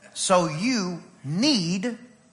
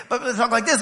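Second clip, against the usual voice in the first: ordinary delivery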